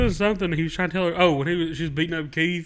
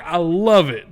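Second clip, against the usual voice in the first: ordinary talk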